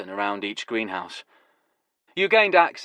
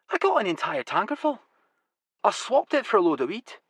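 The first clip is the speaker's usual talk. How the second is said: higher voice with a different timbre; boasting about a clever trade; pleased trader; Huey's voice; 11 semitones higher than usual. Acted. Huey boasts of the fuel swap.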